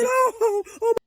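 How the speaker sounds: very high, loud stunned oh; shocked gasp. Retold or imitated. Girlfriend realizing he was the one jumped, shocked. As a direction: gasp as it dawns on you.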